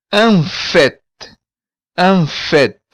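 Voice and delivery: en fait with the final t sounded; Creole phrase. Shown as a demonstration. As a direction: say en fait as "an FET". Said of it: "consoantes são pronunciáveis".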